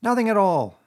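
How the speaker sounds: high, loud and firm; firm reply. A narrator's reading. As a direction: shut the question down flatly. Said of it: "replied the rat firmly".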